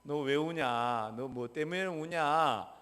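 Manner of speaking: put-on slow, soft coaxing question to a child; gentle asking. Preacher voicing a gentle parent asking the crying child, slow and soft. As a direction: ask the child kindly, slowly.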